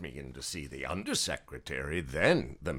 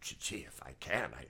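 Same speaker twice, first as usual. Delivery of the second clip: embarrassed whisper; 10 dB quieter than usual. Acted. Embarrassed offer of money.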